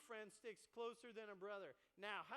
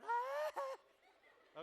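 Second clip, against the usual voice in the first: very high, loud squealing yell; laughing mock kid scream; 12 dB louder than usual. Preacher mimicking little kids fighting, a very high laughing scream. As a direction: squeal like a tiny kid in a scuffle.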